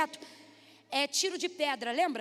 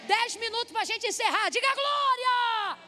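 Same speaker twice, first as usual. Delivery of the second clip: high, loud calls to the crowd; rousing shout; 7 semitones higher, 3 dB louder than usual. Real, not acted. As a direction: whip the congregation up. Preacher rouses congregation to respond.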